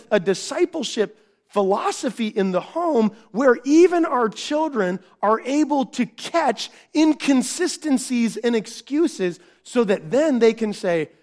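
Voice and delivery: normal talking voice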